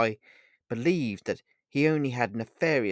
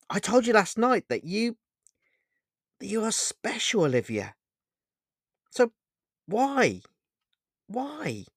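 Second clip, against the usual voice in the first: high-pitched, wounded indignation; angry; 8 semitones higher than usual. A narrator's reading. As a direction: plead your case angrily, halting and repeating. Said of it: "and so angry".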